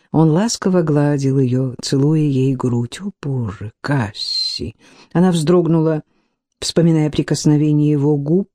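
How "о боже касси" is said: passionate murmur. Acted. Lovers in bed, passionate whisper.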